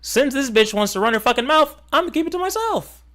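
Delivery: high, mocking donor voice rising to a peak. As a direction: sneer it out as a huffy rich donor.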